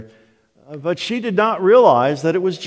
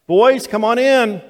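put-on high-pitched call out to children outside; light, raised-pitch motherly call; mom calling kids; 8 semitones higher, 4 dB louder than usual. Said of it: "Mom could call us".

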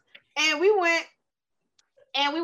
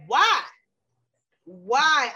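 ordinary delivery

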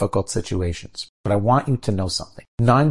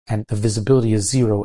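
ordinary talk

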